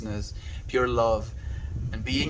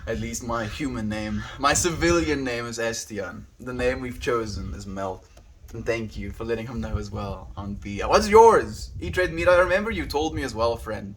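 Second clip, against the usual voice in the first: ordinary talk, nothing marked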